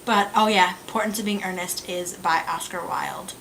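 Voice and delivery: usual speaking voice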